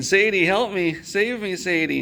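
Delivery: mock panicked shout for Sadie; calling for help. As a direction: yell to the dog as you're carried off. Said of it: "And I'm saying".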